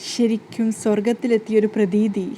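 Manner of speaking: slow, dazed and faraway, like still in the dream; dazed dreamer. Acted. Govind, still dazed by his dream, speaks slowly. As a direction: drift through it, half lost in a dream. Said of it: "കിളി പോയ അവസ്ഥയിലാണ് പറയുന്നത്".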